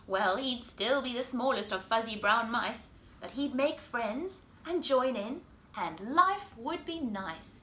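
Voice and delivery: put-on lively storybook voice for kids; reading to children. A narrator's reading. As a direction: read it like bedtime story time. Reads picture book to children.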